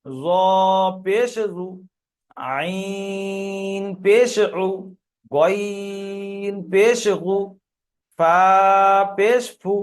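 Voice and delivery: "আইন" and "গইন" held very long on a steady note; slow drill, long "আইন", brief final "উ"; spelling recitation with pesh. Shown as a demonstration. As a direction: stretch each letter name, then a short u after "পেশ". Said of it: "পেশ লাগিয়ে উচ্চারণ করছি".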